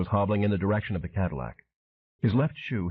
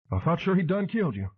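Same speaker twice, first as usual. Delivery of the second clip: much higher holler in a Southern voice; hollered over wind; 10 semitones higher than usual. A narrator's reading. Pelvis to Flint in a speeding car: high holler. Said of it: "Pelvis hollered over the hot wind".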